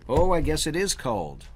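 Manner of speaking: ordinary delivery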